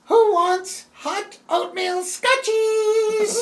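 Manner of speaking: high, slow singsong elf call; christmas elf. Acted. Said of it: "you hear an elf voice that cries out".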